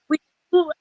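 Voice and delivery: choppy broken-up connection; tape dropouts. Real, not acted. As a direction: voice cutting in and out. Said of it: "Your, your sound keeps cutting out".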